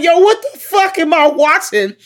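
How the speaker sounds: frightened disbelief. Retold or imitated. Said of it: "'Cause I'm like".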